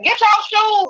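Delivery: high-pitched mother's command; rally the kids for a fight, acted out; urgent rallying. Mother telling her kids to head out and fight, urgent.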